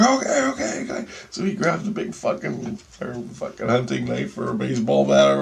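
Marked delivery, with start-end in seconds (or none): 0.0-1.1 hurried cousin. Retold or imitated.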